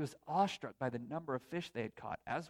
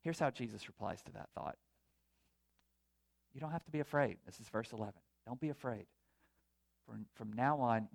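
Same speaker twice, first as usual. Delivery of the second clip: normal talking voice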